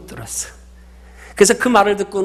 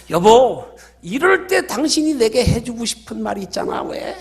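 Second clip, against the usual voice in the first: higher, wheedling wife voice; coaxing aegyo; 5 semitones higher than usual. Retold or imitated. Wife hinting to her husband for the answer, coaxing aegyo. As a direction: act cutesy, fishing for sweet words. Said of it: "온갖 애교 섞인 목소리로".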